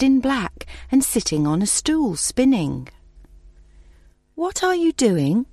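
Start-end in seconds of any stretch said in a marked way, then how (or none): 4.3-5.5 put-on high, curious young princess; a light girlish princess voice; cartoon princess. A narrator's reading. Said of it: "asked the princess".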